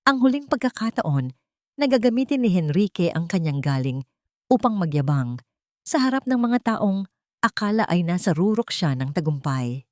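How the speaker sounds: ordinary delivery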